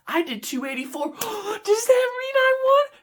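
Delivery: rising high into a gasped question; dawning excitement. Acted. Bidder realising he won, gasping mock amazement.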